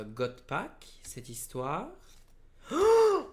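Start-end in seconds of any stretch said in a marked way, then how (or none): 2.7-3.3 real high, loud gasping "oh"; sudden stunned shriek; shocked cry. Real, not acted. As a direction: let out a high cry of disbelief. Discovers a rare god pack.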